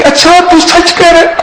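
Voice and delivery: high, astonished "really, you're serious?"; a wife's amazed disbelief, pitched high; disbelieving wife. Retold or imitated. Wife on the phone, high and disbelieving. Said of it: "وہ کہنے لگی".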